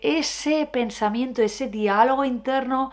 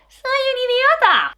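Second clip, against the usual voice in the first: much higher cartoonish voice; silly high voice; 5 dB louder than usual. Shown as a demonstration. Showing how a silly voice drains the thought. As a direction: squeak "Soy un idiota" way up high.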